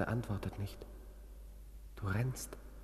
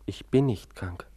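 louder, slow, firm denial; defiant; 7 dB louder, slower than usual. Acted. Patient defying his doctor, loud and slow. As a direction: plant each word against him.

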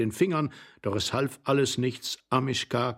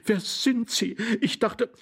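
high, bewildered female voice; startled lady's question; aghast lady; 11 semitones higher, slower than usual. A narrator's reading. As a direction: ask in stunned surprise, pitched high. Aghast lady faces stranger.

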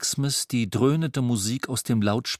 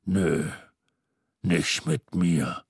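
much lower, slow sulky refusal; Mister Xmas refusing Christmas, deep and sulky; grumpy refusal; 7 semitones lower, slower than usual. A narrator's reading. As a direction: drag out the no.

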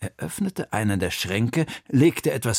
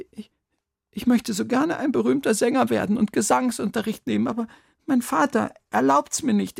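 put-on very high child's voice, pleading; pleading boy; 12 semitones higher, 4 dB louder than usual. A narrator's reading. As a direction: beg like a boy caught out. (flehende, hohe Jungenstimme)

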